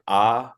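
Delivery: 'ah' said as a long, open vowel; clearly demonstrated 'ah'. Shown as a demonstration. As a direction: say 'ah' slowly and open, as a letter sound. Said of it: "you pronounce it like that".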